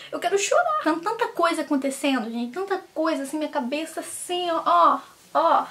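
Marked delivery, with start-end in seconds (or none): 0.1-0.8 rising high plea to cry; whiny wail. Real, not acted. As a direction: whine like about to cry. Venting, wants to cry.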